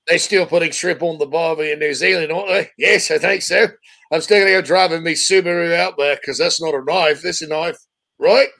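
hammed-up Australian-style New Zealand accent; mock Kiwi accent. Host clowning as a Kiwi after his mistake, mock New Zealand accent. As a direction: joke through a broad put-on Antipodean accent. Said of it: "Oh, he's a Kiwi".

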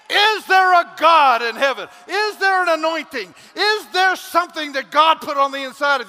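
loud, high, shouted sermon; full-force pulpit preaching; fiery preaching. Acted. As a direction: preach it hard to the congregation.

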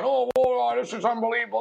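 high, whiny mock complaint, a touch hammed-up; mock whining LeBron. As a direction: parody a star moaning about a call.

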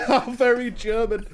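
dry joke through laughter; laughing. Real, not acted. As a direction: laugh while saying it. Joking about German zoo.

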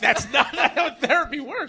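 high, slow protest through laughter; laughing protest. Real, not acted. Podcast host objecting to a friend's idea of therapy, laughing protest. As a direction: laugh while objecting in disbelief.

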